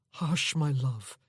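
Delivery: slow, tender soothing; a lover calming her, slowly; tender hush. Acted. Soothing terrified woman.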